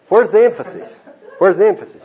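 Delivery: loud high repeated question; stress question. Shown as a demonstration. Preacher quizzing the congregation, loud and high. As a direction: ask it twice, pressing hard. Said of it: "It's on holy, not on kiss".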